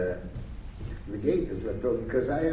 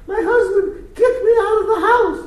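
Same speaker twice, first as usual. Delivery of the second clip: high, loud, tearful woman's voice breaking through; crying wife; 13 dB louder than usual. Retold or imitated. Pregnant woman at his door at night, high tearful wail. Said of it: "she's crying her heart out".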